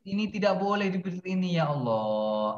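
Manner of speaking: drawn-out, high singsong lament, dipping on the sigh to God; exasperated singsong. Real, not acted. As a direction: plead with hopeless pupils in long, lilting phrases. Teacher despairing over pupils' mistakes.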